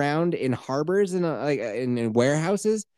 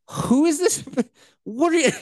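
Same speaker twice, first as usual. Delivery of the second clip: high, louder incredulous yell; mock outraged Don Draper; 12 semitones higher, 3 dB louder than usual. Don Draper, furious at his ad team, in a high mock yell. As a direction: play it as a hammed-up boss who can't believe his ears.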